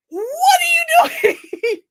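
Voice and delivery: high, slow shouted question; shrieking at the man outside; shrieking retort. Retold or imitated. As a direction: scream it through the door. She shouts at stalker.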